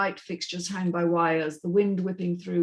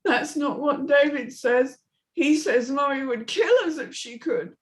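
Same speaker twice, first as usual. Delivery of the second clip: put-on high child's voice, worried; a small girl frowning at her father; little girl; 6 semitones higher than usual. Amy's voice.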